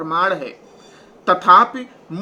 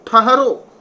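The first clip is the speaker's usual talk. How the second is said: calling stop; 3 semitones higher, 6 dB louder, slower than usual. Retold or imitated. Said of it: "ठहरो शब्द इसीलिए आया है कि सभा ने रोकने का प्रयास किया".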